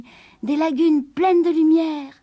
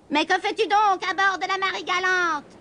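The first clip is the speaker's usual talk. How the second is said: higher, lightly voiced witch; a hint of a suspicious witch; witch asking; 7 semitones higher than usual. A narrator's reading. The witch questions the kitten on the ship, lightly voiced. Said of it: "lui demanda la sorcière".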